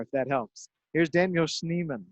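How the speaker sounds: ordinary talk, nothing marked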